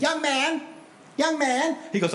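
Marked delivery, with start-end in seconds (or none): 0.0-0.6 put-on much higher, lilting call of an old lady. Old lady calling the pastor from behind, high and lilting. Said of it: "this little old lady in a walker in her nineties". 1.2-1.8 put-on much higher, rising call of an old lady. Old lady in a walker hailing him, high call. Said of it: "this little old lady in a walker in her nineties".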